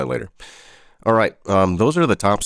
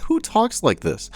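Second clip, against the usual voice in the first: incredulous; 11 semitones higher than usual. Real, not acted. Incredulous after laughing.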